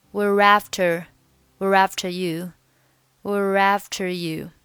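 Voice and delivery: were after run into one, r carried over; linked were after. Shown as a demonstration. Teacher drilling were after. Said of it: "辅元连读".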